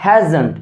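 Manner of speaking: "hasn't" as "hae-jen"; pronunciation demo. Shown as a demonstration. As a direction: say "hasn't" in two syllables, j-like middle. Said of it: "has not-কে আমরা কি".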